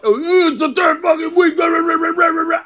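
high shouted mock of an irate ref, then blah blah; mocking angry referee.

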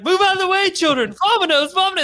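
mock-panicked dad shooing kids away; frantic movie dad. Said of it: "and he's like".

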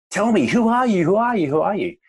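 pitched high and loud, demanding an answer; desperate yell. A narrator's reading. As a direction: cry out in frustration, again and again. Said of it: "he yells out".

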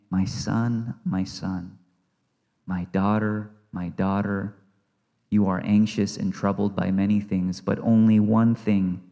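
soft tender voice of God; tender call. Acted. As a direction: speak it like a loving parent calling a child near. God tenderly addressing listeners.

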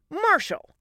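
high, sharp falling name call; snapped. Acted. Wife catching husband playing doctor, snapping. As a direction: snap it as a short reprimand. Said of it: "Allie snapped".

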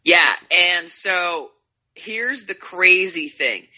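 slow, dramatic setup; slow build-up. Real, not acted. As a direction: stretch it out for suspense. Build-up before reveal.

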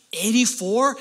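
high, loud incredulous question; surprised echo, rising at the end; mock disbelief. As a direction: blurt it back as a disbelieving question. Acts out his reaction to score.

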